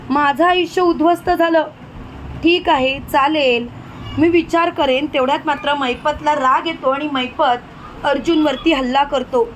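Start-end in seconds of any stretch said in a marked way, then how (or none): none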